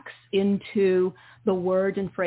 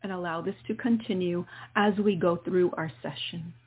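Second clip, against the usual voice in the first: guided meditation. Acted. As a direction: ease the listener into calm breathing. Leads a guided healing meditation.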